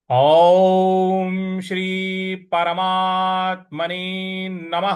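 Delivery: drawn-out Sanskrit chanting, Om held long; slow recitation. Shown as a demonstration. Teacher leads students in slow Sanskrit recitation. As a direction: chant each syllable very slowly. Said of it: "तो आइए पठन शुरू करते हैं".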